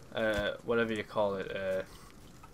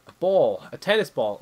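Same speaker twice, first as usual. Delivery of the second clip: excited; 4 semitones higher, 7 dB louder than usual. Real, not acted. Finds ball in luggage.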